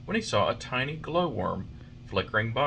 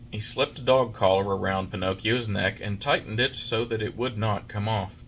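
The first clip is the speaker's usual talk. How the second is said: ordinary delivery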